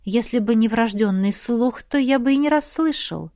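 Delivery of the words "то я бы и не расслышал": usual speaking voice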